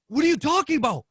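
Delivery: high, baffled question; mock bewilderment. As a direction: sound totally lost. Retold confused denial.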